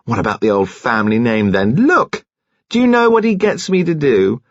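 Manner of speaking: high-pitched indignant rant; bitter outburst. A narrator's reading. Jilted woman rants to the nurse, bitterly. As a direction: pour out the grievance, pitch leaping on key words.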